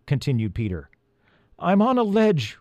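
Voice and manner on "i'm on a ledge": high-pitched answering call. A narrator's reading. Elf on the ledge answers Peter, calling up.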